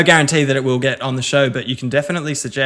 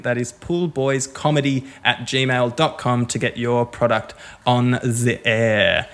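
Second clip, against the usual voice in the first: ordinary talk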